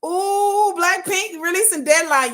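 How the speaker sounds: high, loud thrilled announcement; hyped squeal. Retold or imitated. On her live stream, hyping fans about the new album, excited squeal. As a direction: gush like a superfan who can't wait. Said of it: "I would come on the live stream and be like".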